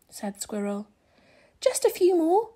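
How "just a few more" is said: eager. A narrator's reading. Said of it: "Rabbit replied".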